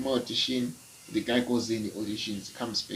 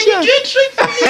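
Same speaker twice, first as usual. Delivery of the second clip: put-on high, loud little kid; child voice; 9 dB louder than usual. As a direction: beg like an eager small child. Kid asks for a trick.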